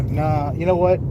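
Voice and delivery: ordinary talk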